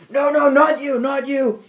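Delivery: high, loud panicked plea; frantic movie quote. Retold or imitated. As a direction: beg in a panic. Quoting frantic movie line.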